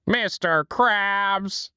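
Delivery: high, slow exasperated whine; Squidward shout. Retold or imitated. Squidward calling out to Mister Krabs, high and slow. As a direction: drag it out annoyed.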